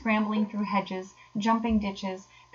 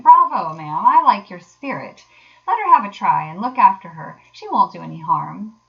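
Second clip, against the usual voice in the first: loud, hearty bravo; booming encouragement; hearty approval; 9 dB louder than usual. A narrator's reading. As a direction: cheer her on, big and emphatic. Gentleman cheers Toad's request.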